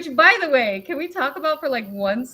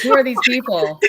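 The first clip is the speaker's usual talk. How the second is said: laughing read-out; 4 semitones higher, 7 dB louder than usual. Retold or imitated. Laughing while reading out messages.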